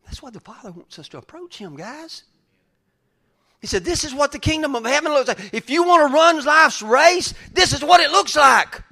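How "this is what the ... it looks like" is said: loud, high preaching quote; fired-up preacher quoting scripture. Acted. Preaching at full force.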